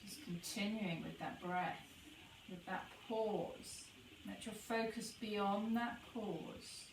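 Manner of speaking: soft, unhurried meditation voice with long pauses; guided meditation. Acted. Guide leading listeners to notice the breath pause, soft meditation voice. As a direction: ease them into the breath, gently.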